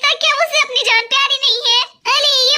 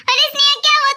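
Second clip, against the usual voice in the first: normal talking voice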